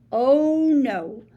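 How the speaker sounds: usual speaking voice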